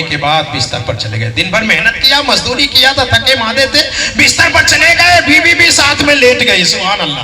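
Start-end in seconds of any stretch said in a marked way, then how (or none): 4.2-7.2 loud, high impassioned sermon; raised preacher's voice; fiery preaching. Acted. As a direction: build it up like a pulpit climax. Preacher shouting over music.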